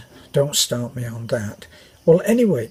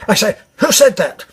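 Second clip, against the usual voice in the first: higher, louder sudden upper-class demand; indignant posh officer; 7 semitones higher, 8 dB louder than usual. Retold or imitated. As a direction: snap to attention and demand the culprit. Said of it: "suddenly sobered up and said".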